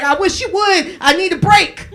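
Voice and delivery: loud, high, fed-up mock yell; exasperated mom shout. Mom answering the threat to call CPS, exasperated yell. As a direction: shout back as an exasperated mother.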